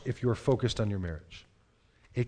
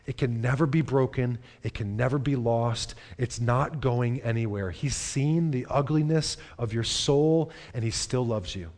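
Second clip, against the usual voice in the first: usual speaking voice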